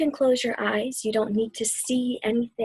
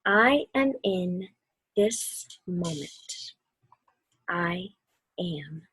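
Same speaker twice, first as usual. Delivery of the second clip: calm storybook reading; 2 semitones lower than usual. A narrator's reading. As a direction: read it gently, letting each word settle. Reads the book's calm ending.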